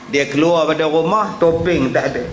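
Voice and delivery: ordinary talk, nothing marked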